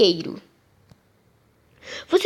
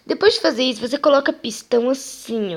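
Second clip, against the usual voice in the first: usual speaking voice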